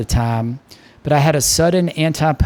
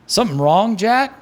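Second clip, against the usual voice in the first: lightly voiced Baltimore barman; hearty bartender, a hint of Baltimore; bartender Mick; 8 semitones higher than usual. Mick questions Jack.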